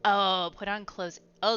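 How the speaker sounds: ordinary delivery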